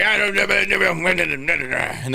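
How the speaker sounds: high, garbled Southern mumble imitation; unintelligible Southern talk. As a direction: imitate an incomprehensible Southern accent. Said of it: "sometimes you can't understand 'em".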